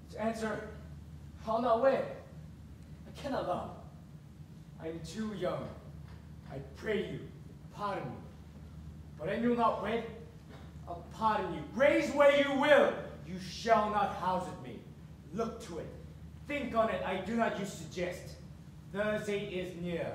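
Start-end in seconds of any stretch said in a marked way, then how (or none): 3.1-8.2 low, soft quoting of her excuses; mocking mimicry. Father mimics daughter's excuses, quietly mocking. 13.3-20.1 low, soft ultimatum; quiet threat. Acted. Father threatens to disown daughter, low and quiet menace.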